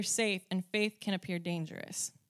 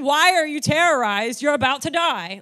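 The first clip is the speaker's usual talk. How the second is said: mock alarmed; 4 semitones higher, 10 dB louder than usual. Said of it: "So he's not saying".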